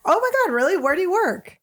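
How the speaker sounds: high-pitched, gushing mock interest; feigned surprise. Retelling answer to the girl about the puppy, mock surprise.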